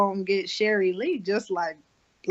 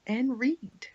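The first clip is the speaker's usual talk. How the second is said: whisper; 4 semitones higher than usual. Real, not acted. Whispered plug.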